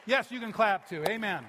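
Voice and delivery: big, loud cheer to the crowd; rally the congregation with a loud amen; preacher urging the church to say amen, loud; rousing amen. Real, not acted. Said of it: "And all God's people said".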